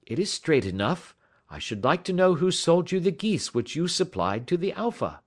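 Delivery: Holmes, unruffled. A narrator's reading. Holmes asking who sold the geese, unruffled.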